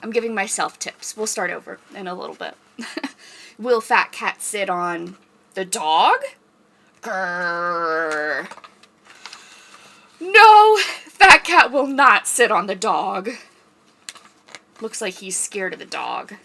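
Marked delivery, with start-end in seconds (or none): 3.6-6.2 slow, drawn-out read-aloud question; reading to children. Pretend. Librarian reading picture book to kids, slow suspenseful question. 7.1-8.5 low growl; dog growl. Pretend. Librarian growling as dog for kids, low growl. 10.2-13.3 high, loud no; emphatic storybook no. Pretend. Reading picture book aloud.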